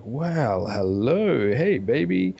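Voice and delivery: leering, drawn-out come-on; womanizer. Retold or imitated. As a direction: greet her like a swaggering ladies' man. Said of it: "Trevor is just a real womanizer".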